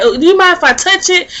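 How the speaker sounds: lightly acted curious asker; imitated curiosity. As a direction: imitate someone genuinely curious, asking permission. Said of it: "She sound like she's really legit curious".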